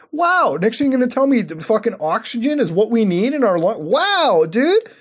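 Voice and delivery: high, loud sarcastic outburst; tilted, mock amazement. Real, not acted. Streamer mocking obvious facts to viewers, tilted and sarcastic. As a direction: feign astonishment at the blindingly obvious. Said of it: "I'm getting kinda tilted over here".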